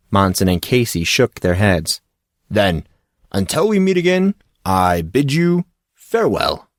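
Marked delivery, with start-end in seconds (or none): 2.5-6.7 bow out theatrically, slowing at the end; a hint of a pompous coach bidding goodbye; grand farewell.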